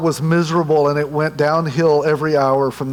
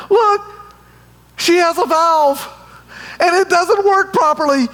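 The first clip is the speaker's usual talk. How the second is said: geeked out; 11 semitones higher than usual. Retold or imitated. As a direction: gush like a tech geek spotting a leaky valve. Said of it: "I'm just so geeked out about the technology".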